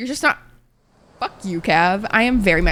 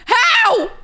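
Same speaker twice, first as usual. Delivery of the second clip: a high, loud cry of disbelief; high-pitched disbelieving how; outraged disbelief; 14 semitones higher, 4 dB louder than usual. Real, not acted. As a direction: squeak it out in shock. Outraged at game stat.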